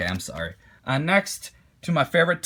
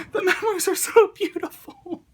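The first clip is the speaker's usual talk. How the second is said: laughing half-crying. Real, not acted. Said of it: "I am super excited".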